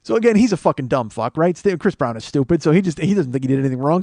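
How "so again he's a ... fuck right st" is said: ordinary talk, nothing marked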